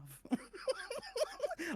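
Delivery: high mocking cackle; lightly imitated cackle; copied mocking laugh. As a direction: mimic her taunting laugh. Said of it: "I copy her laugh".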